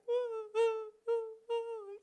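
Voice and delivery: high, comic wailing sobs; mock sobbing. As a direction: bawl like a passenger crying on a ride. Imagined crying on the back of Gojek.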